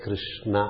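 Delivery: KRISHNA as Krish-naa, open final vowel; two-syllable Krishna. Shown as a demonstration. Teacher showing how we say it, Krishna in two syllables. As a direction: split Krishna and sound the last a. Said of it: "It becomes two syllables".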